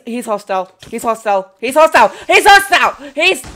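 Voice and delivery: from alarmed to a loud high panicked shout; rising panic. Real, not acted. Player attacked by a hostile mob, building to a panicked shout.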